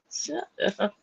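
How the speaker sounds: ordinary talk, nothing marked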